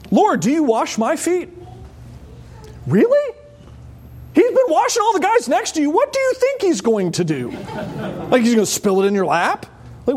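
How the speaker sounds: high, disbelieving protest; incredulous Peter. Retold or imitated. Preacher voicing Peter, high and incredulous. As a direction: Peter can't believe Jesus would do this.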